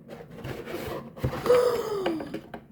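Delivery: high excited gasp; sharp thrilled intake of breath. Real, not acted. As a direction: gasp in awe at the unboxing. Second look at the item.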